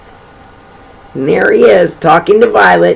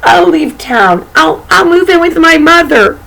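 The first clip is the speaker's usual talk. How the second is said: higher, stammering plea; pleading wolf; 9 semitones higher than usual. A narrator's reading. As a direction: beg to be let go, voice rising. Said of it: "said the wolf".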